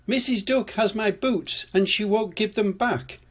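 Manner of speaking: put-on high-pitched child character calling. A narrator's reading. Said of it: "Pepper called".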